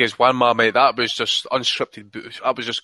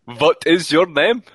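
put-on slow, deliberate German-accented question; mock German accent; 4 semitones higher, 3 dB louder, slower than usual. Co-host mimicking the fake journeyman's interview, mock German accent.

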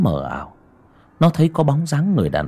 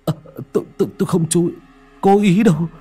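higher, louder stammering plea; terrified begging; 9 semitones higher, 3 dB louder than usual. Acted. Tèo begs the ghost to spare him, stammering in terror. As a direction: beg for your life, stuttering. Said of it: "nó mới run rẩy sợ hãi, van xin".

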